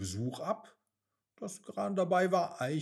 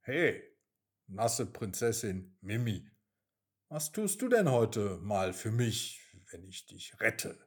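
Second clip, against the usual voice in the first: lower, sly grinning rat; 6 semitones lower than usual. Acted. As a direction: bargain with a smirk. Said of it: "mit einem listigen Grinsen". (listige Ratte, tiefer, mit Grinsen)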